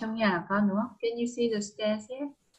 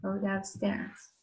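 "Go downstairs." slow and softer; slow soft model; 2 semitones lower, 6 dB quieter, much slower than usual. Shown as a demonstration. Teacher repeats the phrase for the child, slow and soft. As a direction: model it slowly and gently. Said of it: "Well done".

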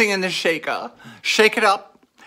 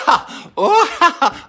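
high laugh; 12 semitones higher, 6 dB louder, slower than usual. Real, not acted. Laughing at viewer's moves.

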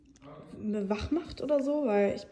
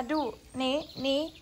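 ordinary talk

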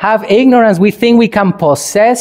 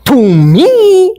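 offended ego; 4 semitones higher, slower than usual. Acted. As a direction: play the wounded ego, outraged at being challenged. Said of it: "What does ego say?".

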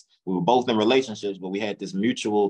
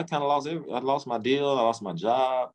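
usual speaking voice

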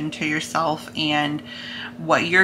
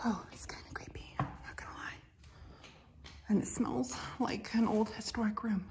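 hushed whisper; 4 semitones higher, 13 dB quieter than usual. Real, not acted. Hushed inside creepy old church.

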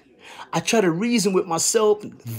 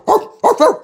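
quick high laugh; short high burst of laughter; 12 semitones higher, 4 dB louder than usual. Real, not acted. Laughs at the dog line.

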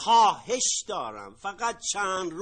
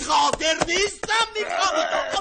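higher and louder, angry; exasperated shout; 9 semitones higher, 5 dB louder than usual. Acted. Debtor losing his temper.

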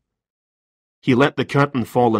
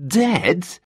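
shocked gasp, pitched high; 9 semitones higher than usual. Acted. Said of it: "he gasped".

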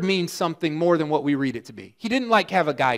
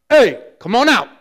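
loud, higher call to a grave; pretend Jesus shouting; 5 semitones higher, 8 dB louder than usual. Pastor pretending to be Jesus at the tomb, loud call. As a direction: call the dead man out loudly. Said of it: "I'd be walking up being like".

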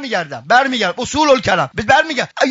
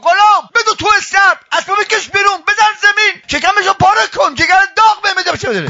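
high, driving string of orders; urgent retold command; 9 semitones higher than usual. Master sends servant to stable, urgent high command. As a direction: fire off orders in a raised voice.

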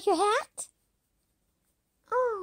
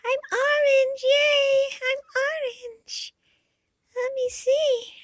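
much higher, louder joyful squeal; overjoyed cheer; 4 dB louder than usual. Acted. Yoshi thrilled to find he is orange, cheering. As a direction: burst out in delight. Seeing himself orange at last.

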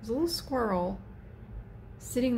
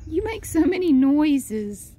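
louder pet talk; 6 semitones higher, 8 dB louder than usual. Real, not acted. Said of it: "repeated higher".

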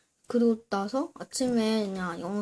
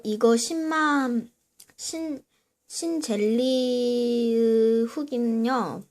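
slow announcing; 2 semitones higher, 5 dB louder, slower than usual. Real, not acted. Slowly announcing the review title.

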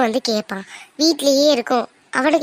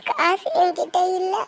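sobbing; 6 semitones higher than usual. Acted. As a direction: sob out the whole story to her brother. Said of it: "தேம்பி தேம்பி அழுதுட்டு இருக்க". (தேம்பி தேம்பி அழுதபடி)

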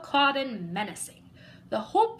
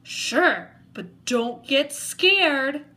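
slow, louder, eerie monster voice; spooky monster; 4 semitones higher, 7 dB louder, much slower than usual. Acted. Monster warning the kid before showing its tail, slow and eerie. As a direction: drag the words out like a creature under the bed.

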